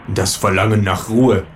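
ordinary delivery, nothing marked

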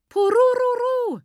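high, loud, drawn-out joyful trilling cry; jubilant ululation. A narrator's reading. Lucia celebrates her uncle's news, loud jubilant ululation. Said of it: "jubelte Lucia laut und anhaltend".

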